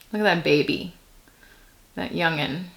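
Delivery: ordinary delivery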